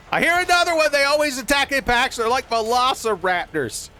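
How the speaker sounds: high, loud panicky alert; alarmed. Real, not acted. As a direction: yelp it out as the pack closes in. Dogs attacking during play.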